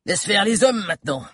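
plus aigu, ricanement méprisant; un méchant de théâtre qui nargue; ricanant. Acted. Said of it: "ricana-t-il". (Bill ricane avec mépris, voix aiguë)

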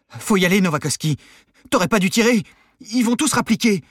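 high, loud frantic urging; panicked. A narrator's reading. Nervous accomplice urges gunman to flee, panicked shout. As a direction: urge him to flee, in a panic. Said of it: "saisi de panique".

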